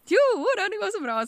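high, loud, cheerful reply; cheerful mother. Retold or imitated. Norwegian mother happily lending shampoo, high and loud. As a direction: sing out a cheerful yes. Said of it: "Men du, kan inte jag få lite shampoo".

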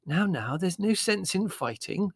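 calm the squabblers, unhurried; placating. Acted. Mother calming the quarrel.

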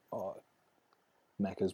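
ordinary talk, nothing marked

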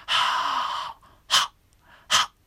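unvoiced ha, then a sharp stop; breathy ha exhale. Shown as a demonstration. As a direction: breathe out ha and cut it. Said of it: "広がってる状態ですね".

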